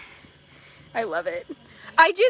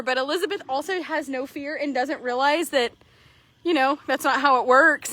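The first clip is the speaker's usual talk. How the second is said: usual speaking voice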